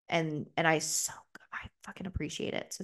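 soft breathy whisper of gratitude; whispered thanks. Real, not acted. Thanking her girlfriend in a real whisper. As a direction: whisper it, too moved to speak up.

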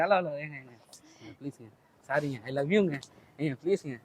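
low, very quiet apology; soft pleading. Acted. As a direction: plead gently with someone annoyed. Pleading for love after being rebuffed.

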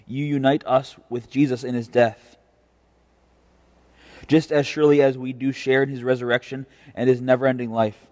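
ordinary talk, nothing marked

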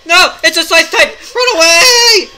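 high loud panicked shrieks; panicked screaming. Real, not acted.

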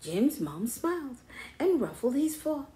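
ordinary delivery, nothing marked

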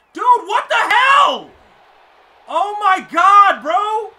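high, drawn-out furious shout; outraged yell. Real, not acted. Gamer sees his play fail, real outraged screaming. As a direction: scream in disbelief at the game.

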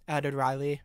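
ordinary delivery

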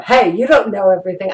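ordinary delivery

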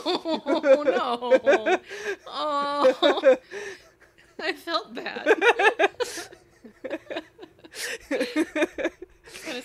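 loud high laughing sympathy. Real, not acted. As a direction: laugh while saying sorry. Reacting to the sad noise.

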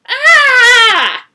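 long, high, loud scream; piercing scream of revulsion; horrified shriek. Acted. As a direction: scream in utter disgust. Second shriek, flinging severed head.

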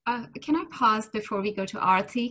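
usual speaking voice

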